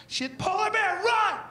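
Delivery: mock panic, high and loud; panicked shout. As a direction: yell a warning as the bear comes. Panicked penguin scene.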